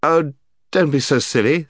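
put-on high, loud exasperated boy; Scrub. A narrator's reading. Scrub argues against the enchantment.

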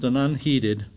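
ordinary delivery, nothing marked

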